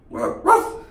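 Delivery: high mock dog bark. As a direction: yap like a small dog. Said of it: "more like that lassie".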